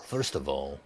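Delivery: low, quiet, dismissive shopkeeper; stern shopkeeper. Acted. Shopkeeper turning on a customer about armor, low and disdainful. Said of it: "he turns to you and he says".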